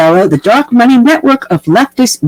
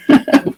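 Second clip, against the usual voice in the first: short laugh at your own pun; real, brief laugh; chuckle; 3 dB quieter than usual. Real, not acted.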